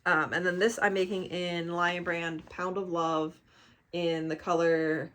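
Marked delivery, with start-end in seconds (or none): none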